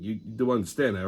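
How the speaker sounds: ordinary talk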